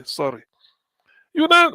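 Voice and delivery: ordinary talk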